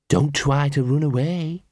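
slow character voice of a menacing dog; threatening henchdog. Acted. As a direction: warn them slowly, as a thug dog.

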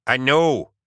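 loud, irritated snap; spat. A narrator's reading. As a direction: bite back at him. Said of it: "spat Rawn".

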